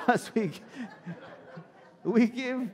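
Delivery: can't hold back a laugh at his own punchline; real laughter breaking into the sermon. Real, not acted.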